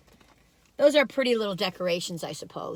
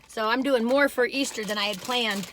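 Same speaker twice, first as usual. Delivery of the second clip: normal talking voice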